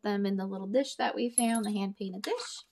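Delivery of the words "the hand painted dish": ordinary delivery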